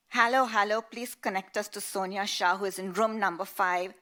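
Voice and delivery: shouting down the phone. Acted. Grandfather shouting into an international call to the dorm. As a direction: yell to be heard overseas. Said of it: "shouted Dadaji".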